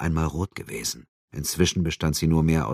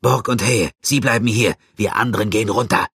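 clipped orders, higher and louder; commanding officer; 5 semitones higher, 5 dB louder than usual. A narrator's reading. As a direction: give the order fast and firm. Lieutenant gives orders. (Befehlston eines Offiziers)

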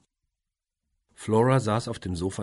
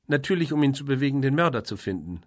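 fast, loud, higher retort; sharply; 8 semitones higher, 7 dB louder than usual. A narrator's reading. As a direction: fire it back impatiently. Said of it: "sagte Caroline scharf".

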